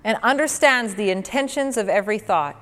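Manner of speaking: scripture reading. Acted. Reads scripture.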